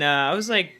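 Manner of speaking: ordinary talk, nothing marked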